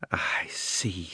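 scornful. A narrator's reading. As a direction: breathe it out with contempt. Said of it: "she said scornfully".

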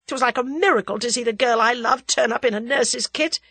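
loud, passionate rant; angry outburst. Acted. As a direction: pour it out, angry and in love. Tommy raging, then confessing love.

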